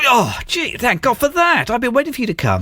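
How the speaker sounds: high-pitched relieved outburst; acted relief. Freed co-host thanks his rescuer, acted high relief. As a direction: gush as the gag finally comes off. Gag just removed in opening skit.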